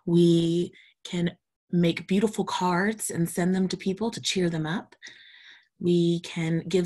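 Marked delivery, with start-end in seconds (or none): none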